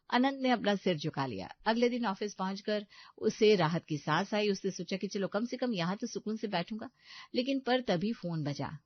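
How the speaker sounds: ordinary delivery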